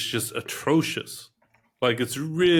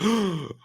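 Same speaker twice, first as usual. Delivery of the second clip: gasp; 6 semitones higher than usual. Retold or imitated. Shocked reaction to dad's cursing.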